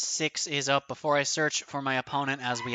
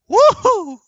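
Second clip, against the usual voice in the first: very high, loud cheer; joyful whoop; 13 dB louder than usual. Real, not acted. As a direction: whoop with delight. Celebrating the win.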